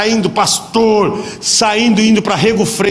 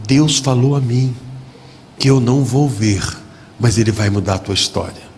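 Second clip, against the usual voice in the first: solemn prophecy; 9 semitones lower than usual. Retold or imitated. Father's prophecy, low and slow.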